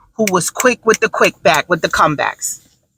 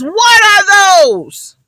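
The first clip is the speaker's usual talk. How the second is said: much higher, slow sneering "What are those?"; mocking kid; 9 semitones higher, 4 dB louder, much slower than usual. Kid mocking another kid's sneakers, high and slow. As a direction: stretch it out like a bully pointing. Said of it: "points to your feet and goes".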